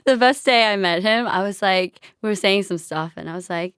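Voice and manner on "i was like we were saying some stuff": usual speaking voice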